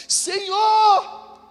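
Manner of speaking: very high, slow, drawn-out plea; mock begging messenger; pleading messenger. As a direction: beg like a desperate messenger.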